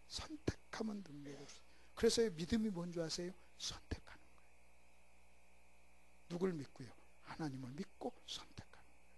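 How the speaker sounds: soft whispered conviction; whispering preacher. Acted. Preacher whispering faith's meaning to the church, hushed. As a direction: whisper it like a secret.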